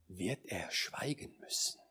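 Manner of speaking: low, whispered, reverent musing; hushed solemnity. Retold or imitated. Preacher recalls a fatherly friend pondering standing before the Lord, hushed solemnity. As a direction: whisper it with long, awed pauses.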